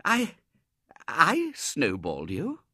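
high, slow, astonished question; stunned, can't believe it; gasped disbelief. Acted. Robert stunned by the Colonel's accusation, gasping disbelief. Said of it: "gasped Robert".

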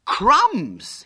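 high, loud mock horror; feigned horror shout. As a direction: cry out in put-on horror. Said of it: "shouted in well-simulated horror".

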